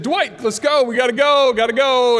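urgent call. Retold or imitated. Teen urging his friend to leave the house, urgent call. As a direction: hurry your buddy out the door. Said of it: "I actually said".

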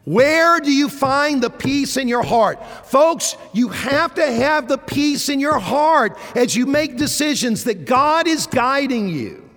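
high, loud, urgent exhortation; impassioned preaching. Real, not acted. Preacher urging folks to find peace in decisions, impassioned. Preaches at full force to congregation.

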